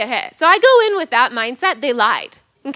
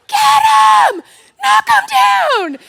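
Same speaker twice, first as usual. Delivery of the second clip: very high, drawn-out sideline scream; overexcited parent yelling, each word stretched; loud yell; 7 dB louder than usual. Acted. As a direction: bellow it across a football field. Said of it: "didn't know I could yell that loud".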